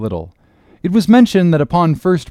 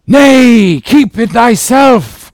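high, loud, drawn-out prophetic refusal; fierce cry; 12 semitones higher, 9 dB louder, much slower than usual. A narrator's reading. Gabriel refusing the letter to Ahab, high loud cry. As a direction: cry it out slowly and forcefully. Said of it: "cried Gabriel to Ahab".

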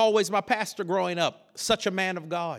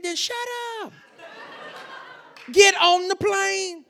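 hammed-up raised-voice command; exasperated father; 12 semitones higher than usual. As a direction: order the son sharply, imitating dad. Father's exasperated order, acted.

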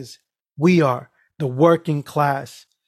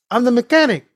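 higher, insistent Spanish-accented mimicry; voice changing; 7 semitones higher, 4 dB louder, faster than usual. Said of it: "his voice started changing".

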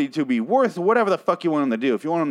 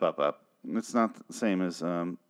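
ordinary talk, nothing marked

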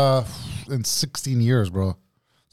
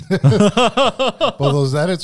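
laughing; 6 semitones higher, 5 dB louder, much slower than usual. Real, not acted. Both laughing at edits.

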